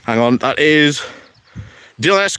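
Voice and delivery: ordinary talk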